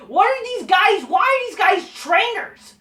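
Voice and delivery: high-pitched angry disbelief; exasperated rant. Real, not acted. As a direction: vent in disbelief. Ranting about trainers.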